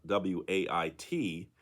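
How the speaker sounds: slow letter-by-letter spelling; spelled out. Shown as a demonstration. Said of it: "That's weight spelled".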